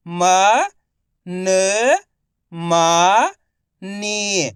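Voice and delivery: म, न, मा, नी each held alone; direct matra sounding. Shown as a demonstration. Teacher showing direct reading, म, न, मा, नी sounded separately. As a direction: stretch म, न, मा, नी with pauses. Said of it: "direct मात्रा का उच्चारण करके पढ़ेंगे".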